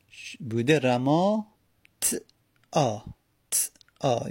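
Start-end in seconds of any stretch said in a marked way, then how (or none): 2.0-4.3 ت آ split into a bare "t", then a separate "aa"; vowelless t. Shown as a demonstration. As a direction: sound ت with no vowel, pause, then آ. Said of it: "یعنی تا ساکنه".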